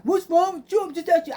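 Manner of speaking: high, urgent pestering; mock worked-up questioning; agitated son. Acts out son pestering her.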